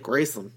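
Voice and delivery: Graceland echoed as GRACE-lund; accented pronunciation. Retold or imitated. Co-host copying the Memphis way, Graceland as GRACE-lund. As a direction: copy the reduced "lund" ending of Graceland. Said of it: "that sounds like an accent".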